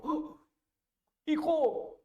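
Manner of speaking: very high surprised cry of recognition; joyful cry. Acted. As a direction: burst out in joy at seeing his son. Padre reconoce a su hijo. (grito de sorpresa y alegría)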